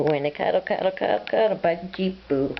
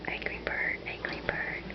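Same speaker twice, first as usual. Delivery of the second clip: whisper; 13 dB quieter than usual. Acted. Whispering to bird.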